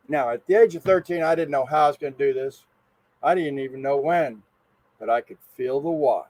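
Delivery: ordinary delivery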